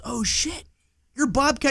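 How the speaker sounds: high, thrilled fan voice; excited recognition. Retold or imitated. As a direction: recognize a star, delighted. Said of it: "recognizes me and goes".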